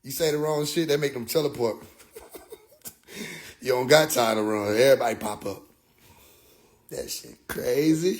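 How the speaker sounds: normal talking voice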